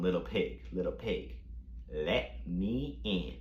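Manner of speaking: low, soft, menacing singsong; big bad wolf. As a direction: play the wolf at the door, hushed and drawn out. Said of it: "unlike the big bad wolf".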